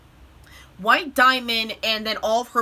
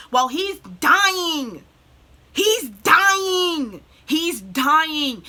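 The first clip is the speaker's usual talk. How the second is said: loud, raised, drawn-out insistence; higher and louder, stretching the key word; distraught outcry; 4 semitones higher, 4 dB louder, much slower than usual. Real, not acted. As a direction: cry it out in anguished disbelief. Distraught outcry recapping the character's death.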